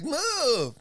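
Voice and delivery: high-pitched imitation of a heckler; mock nagging woman. Lady behind a fighter ordering him aside, high nagging. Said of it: "and she's like".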